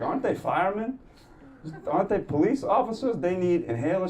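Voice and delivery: high, incredulous questions; mock shocked questions; disbelief. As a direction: sound amazed. Incredulous about firemen.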